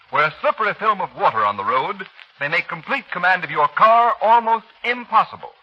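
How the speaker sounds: ordinary talk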